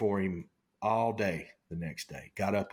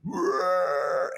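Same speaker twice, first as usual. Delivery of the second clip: mock bull bugle, a touch louder; imitating the elk bugling at them; louder imitated elk bugle; 8 semitones higher, 4 dB louder than usual. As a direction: roar it out like the charging bull. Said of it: "and he's bugling".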